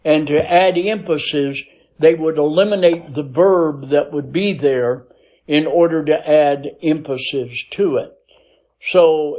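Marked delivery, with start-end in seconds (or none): none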